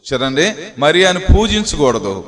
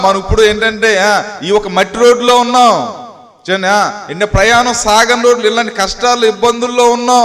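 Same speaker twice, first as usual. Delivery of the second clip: raised-pitch exhortation to press on; rousing preaching; 5 semitones higher than usual. Retold or imitated. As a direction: preach it as a hopeful rallying call. (ఉత్సాహపరిచే ప్రసంగ స్వరం)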